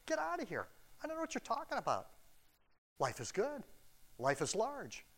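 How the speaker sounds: high, fast, scoffing rich man brushing it off; mock the carefree rich, voice up high; smug dismissal. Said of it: "they're gonna".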